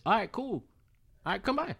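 high, breezy okay; casual okay. Retold or imitated. Man telling the woman to come by, high and breezy. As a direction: agree lightly, almost singing it. Imitating his own casual agreement.

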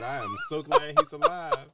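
squeaky high quoted woman, laughing; high mock voice. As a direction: mimic her in a high laughing voice. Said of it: "She said".

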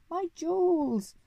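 very high, slow operatic wail; screaming. A narrator's reading. Lady flying out of the house, robbed, screaming. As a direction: shriek in distress, drawing the words out.

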